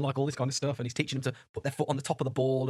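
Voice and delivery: normal talking voice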